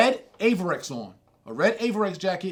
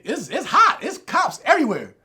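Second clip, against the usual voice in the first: retold panic, higher and louder; mildly panicked; 7 semitones higher, 4 dB louder than usual. Storyteller reliving the walk with cops everywhere, mildly panicked. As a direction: pitch jumps up in mock alarm. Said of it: "I'm panicking a little bit".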